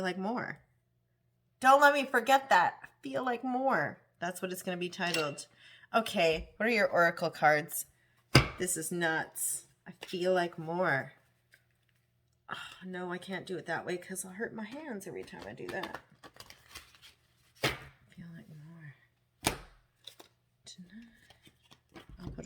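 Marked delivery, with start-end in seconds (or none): 1.6-4.0 higher, louder, thrilled; excited. Real, not acted. Reader naming her video title, excited and loud. 18.1-21.5 soft unvoiced whisper. Real, not acted. Reader whispering a lyric to the cards.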